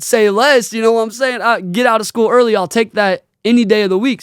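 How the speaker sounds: put-on quoting his teen self cheering; a kid thrilled to skip class; hyped. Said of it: "I said".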